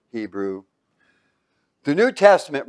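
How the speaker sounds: ordinary talk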